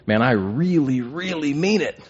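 REALLY repeated with a rising, swooping pitch; earnest stress on the doubled "really"; emphatic. Shown as a demonstration. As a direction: lean hard on "really, really" for emphasis. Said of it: "when you're trying to emphasize something".

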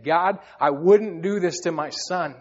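a slow, pleading prayer to God; tearful plea. Retold or imitated. A father tearfully telling God he would never do this to his son. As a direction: say it like tears are welling up. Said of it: "with tears in my eyes saying".

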